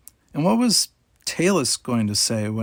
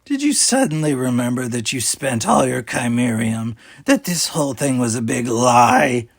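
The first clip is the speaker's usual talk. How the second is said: a sly leader toying with her captives; cool pirate captain; 2 semitones higher, 4 dB louder than usual. A narrator's reading. Talis voiced in story.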